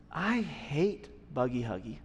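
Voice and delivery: soft, low-pitched imitation of a hurt young son, held back; voicing his young son's quiet confession. Young son quietly telling his dad, soft and hurt.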